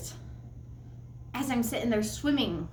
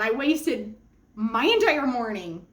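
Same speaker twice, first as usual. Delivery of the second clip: normal talking voice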